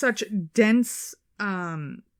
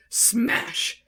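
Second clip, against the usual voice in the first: dramatic smash; 9 semitones higher than usual. Retold or imitated. Boulder falling in story.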